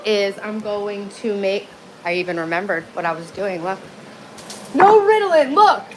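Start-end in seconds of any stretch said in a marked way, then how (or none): 4.8-5.8 excited shout. Real, not acted. Excited outburst while streaming.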